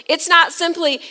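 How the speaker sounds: normal talking voice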